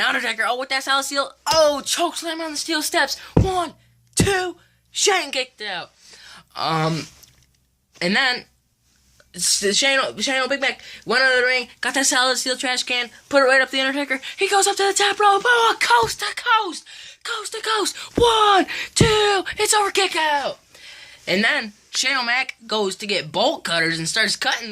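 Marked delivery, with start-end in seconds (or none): 0.0-5.9 high, fast ringside calling; excited play-by-play. Pretend. Kid retelling the match, calling it like live commentary. 14.4-20.6 high, fast ringside calling; excited play-by-play. Pretend. Kid retelling the match, calling it like live commentary.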